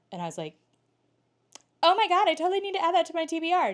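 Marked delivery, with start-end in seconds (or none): 1.8-3.7 much higher, louder and fast, hammed-up delight; mock gushing. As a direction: gush like a starstruck fan. Retelling her eager reply to officer.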